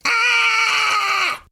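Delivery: very high terrified squeals building to screams; cowardly yelling. A narrator's reading. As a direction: lose it completely in terror. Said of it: "we both cowardly yell together".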